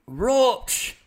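slow, high, dreamy "Roach"; drawn-out dramatic name. Pretend. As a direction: stretch the name out slowly and high. Savoring a favorite name.

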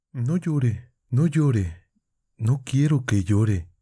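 voz de muchacha más aguda, consoladora; consuélalo con urgencia, más alto; Nastenka consolando. A narrator's reading. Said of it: "dijo Nastenka". (Nastenka le ruega que no llore, voz aguda y tierna)

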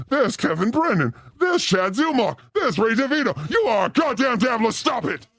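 usual speaking voice

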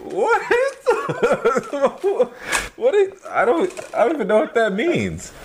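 high, laughing, baffled; laughing disbelief. Real, not acted. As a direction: crack up while asking. Laughing disbelief at odd term.

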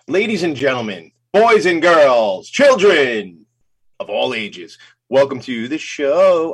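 slow booming circus announcer call; ringmaster. Shown as a demonstration. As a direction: draw out each phrase like a ringmaster. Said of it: "that's not how I do that".

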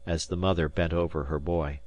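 usual speaking voice

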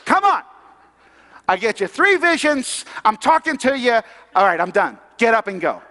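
high, loud pleading that settles into a firm order; exasperated God. Acted. Preacher as God nagging reluctant Peter, exasperated. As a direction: plead like a fed-up parent, then give up. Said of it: "He's like".

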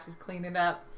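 usual speaking voice

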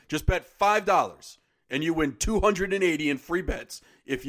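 upbeat sponsor read, punchy. Acted. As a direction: sell it like a radio spot.

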